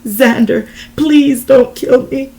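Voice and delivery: loud, high strained plea; desperate begging. A narrator's reading. Desiree begging Xander for her life, desperate plea. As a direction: beg with all your strength. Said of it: "begged with every bit of strength".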